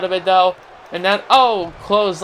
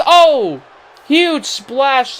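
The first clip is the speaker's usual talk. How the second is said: surprised shout; 6 semitones higher, 5 dB louder, much slower than usual. Real, not acted. Huge splash from top rope.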